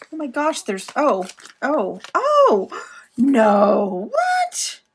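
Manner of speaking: real surprised squeal; delighted shock. Real, not acted. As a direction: gasp and squeal in disbelief. Discovering a hidden pocket.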